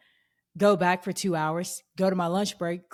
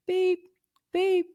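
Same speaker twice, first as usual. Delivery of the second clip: two high squeaky beeps; high-pitched cash register beep; 13 semitones higher than usual. Retold or imitated. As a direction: mimic the scanner going beep beep. Imitating register scanner beeps.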